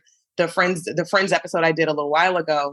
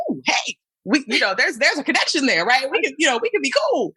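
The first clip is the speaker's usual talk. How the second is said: high, eager and delighted; light up at a fellow smoker; excited; 7 semitones higher than usual. Retold or imitated. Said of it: "I'm like".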